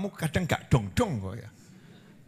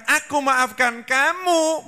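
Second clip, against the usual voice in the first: slow solemn declaration; 9 semitones higher, 4 dB louder, slower than usual. Acted. God's words of forgiveness, slowed.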